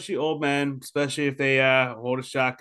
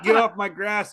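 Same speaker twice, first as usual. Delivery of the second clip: a hint of a cranky old man, laughing; lightly voiced geezer, laughing; trying out an old-man line with a laugh; mock old man; 7 semitones higher than usual. Shown as a demonstration. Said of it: "if you did".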